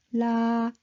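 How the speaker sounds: low, drawn-out "la"; slow, level "la"; low pitched. Shown as a demonstration. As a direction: hold each "la" on a level low note. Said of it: "just a low pitched".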